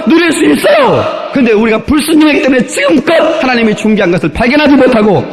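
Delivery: high, impassioned pulpit delivery; preaching at full force. Real, not acted. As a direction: drive the point home, rising to shouts. (힘차게 외치는 설교조)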